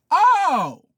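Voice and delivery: loud, high, falling wail; upset cry. Acted. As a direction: cry out high and let it drop. Acts out the upset elder.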